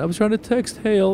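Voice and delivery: put-on high little-boy voice, repeated; little kid voice. Dad repeats son's words.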